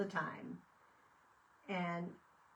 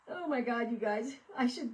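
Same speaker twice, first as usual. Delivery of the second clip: starting to crack up, louder and higher; laughing; 5 semitones higher, 6 dB louder than usual. Real, not acted. Telling viewers about a funny post, laughing. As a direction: giggles breaking through the words. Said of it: "I'm probably gonna start laughing".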